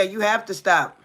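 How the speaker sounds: ordinary talk, nothing marked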